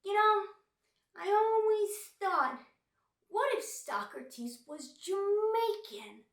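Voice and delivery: high, loud, drawn-out joke setup; slow, high-pitched mock stand-up delivery; imitated young comic. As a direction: mimic an amateur comic belting out his premise. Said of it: "And then he goes".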